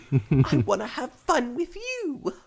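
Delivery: put-on high whiny little girl; child voice. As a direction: whine like a small girl. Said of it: "I have such an amazing child voice".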